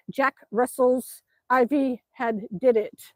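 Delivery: slow, word by word; slow reading. Real, not acted. Streamer puzzling out a viewer's tongue twister, slow reading. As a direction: read it out slowly, feeling out each word. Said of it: "I gotta say that many times".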